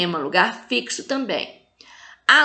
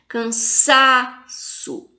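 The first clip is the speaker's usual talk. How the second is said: "Cansaço" slowly, SA held long; very drawn-out SA in "Cansaço"; tonic syllable stressed; much slower than usual. Shown as a demonstration. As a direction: lean hard on SA in "Cansaço". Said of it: "fala com mais intensidade".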